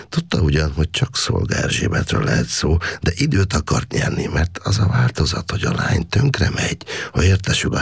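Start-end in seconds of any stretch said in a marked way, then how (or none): none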